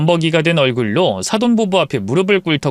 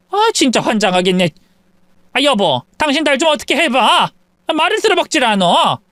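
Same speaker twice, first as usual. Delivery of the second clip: higher, exasperated plea; frustrated mother; 8 semitones higher than usual. Acted. Mother complains to husband about daughter, frustrated. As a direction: turn to your husband.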